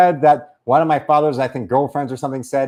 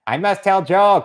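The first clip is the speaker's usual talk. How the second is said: stilted mock Korean accent; caricatured North Korean joker; North Korean comedian. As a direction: declare it as a determined Korean-accented comic. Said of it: "being a North Korean comedian".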